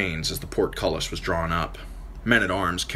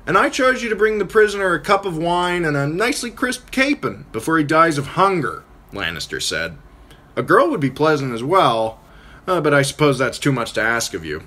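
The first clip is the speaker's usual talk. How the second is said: much higher, louder sly jest; Tyrion's mocking banter; 10 semitones higher, 6 dB louder than usual. Tyrion bantering with Catelyn and Nestor, mocking. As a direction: needle them with mock courtesy.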